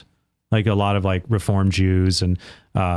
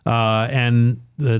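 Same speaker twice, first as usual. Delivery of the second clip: usual speaking voice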